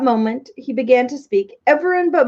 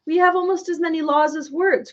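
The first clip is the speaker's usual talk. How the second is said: grumbling; 8 semitones higher than usual. A narrator's reading. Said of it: "grumbled the king".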